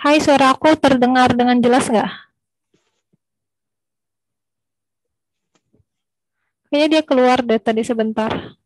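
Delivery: calling out loudly over a bad connection. Real, not acted. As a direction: raise your voice to be heard. Checking if audio comes through.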